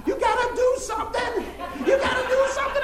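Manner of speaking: shrill panic, laughing as you mimic her; panicked lady. Lady at the funeral begging someone to stop the fight. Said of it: "this one lady go".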